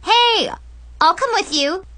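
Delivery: put-on high, loud, perky girl; eager offer. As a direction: pipe up keenly to tag along. Eager friend volunteers.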